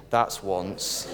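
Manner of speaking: soft, low warning through a laugh; quietly. Acted. Wife in the joke turning on her husband, softly, with a laugh in it. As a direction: keep it quiet and calm as the laugh breaks in. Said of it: "said quietly".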